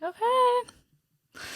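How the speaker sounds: small, very high drawn-out okay; meek compliance. Retold or imitated. Answering the midwife who said stay put, meek compliance. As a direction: pitch it way up, retelling a meek agreement. Retold reluctant reply to midwife.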